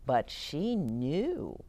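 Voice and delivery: ordinary delivery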